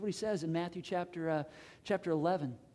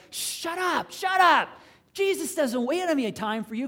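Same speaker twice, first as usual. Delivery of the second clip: loud, high-pitched acted shout; hammed-up angry telling-off; scolding crowd; 10 semitones higher, 10 dB louder, slower than usual. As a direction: shush them harshly like an irritated bystander. Bystanders scold the blind men.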